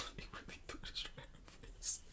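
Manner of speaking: whispered through laughter; laughing whisper. Real, not acted. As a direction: giggle the words out breathlessly. Laughing while recalling scene.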